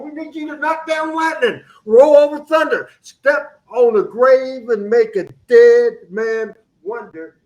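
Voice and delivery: high, loud motivational rant; Dream Machine. Acted. Host firing up football players, hyped motivational speech. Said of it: "I'm gonna steal the Dream Machine".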